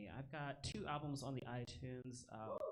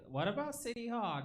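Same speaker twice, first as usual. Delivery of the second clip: mock pleading girlfriend; 12 semitones higher, 10 dB louder than usual. Woman answers, acted.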